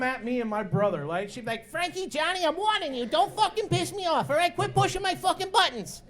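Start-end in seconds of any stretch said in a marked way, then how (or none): 1.7-6.0 high, menacing Joe Pesci mom voice. Retold or imitated. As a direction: threaten the kids like a wiseguy mom. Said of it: "She turns into Joe Pesci".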